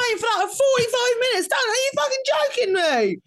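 high, mock-outraged rant; angry British dad. Mocking a fuming British dad in a Disneyland queue. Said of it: "And it's like".

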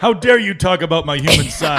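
high, loud sing-song outrage; shrill overlapping reality fight. Acted. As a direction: belt out the indignation. Mock fight, sung outrage.

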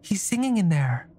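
lightly voiced curious girl; young girl voice.

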